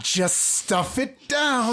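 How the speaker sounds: high, slow sugary fake calm; mock saccharine, pushing it down; fake nice voice. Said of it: "pretend to be nice and say it in a passive aggressive voice".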